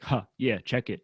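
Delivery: low, lazy rap ad-libs; half-hearted low rapper filler; mock rapper. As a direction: parody a filler-heavy diss track. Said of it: "Tim Savo diss track where I'm just like".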